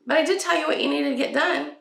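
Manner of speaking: mom's retort. Retold or imitated. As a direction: answer back calmly. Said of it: "And I was like".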